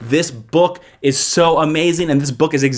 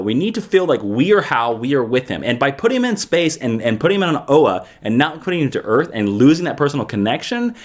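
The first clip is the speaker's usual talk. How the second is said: normal talking voice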